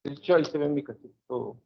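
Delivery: numb tongue after a tooth pulled; numb from the dentist. Real, not acted. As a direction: talk with an anesthetized mouth. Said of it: "Hablo como Kiko".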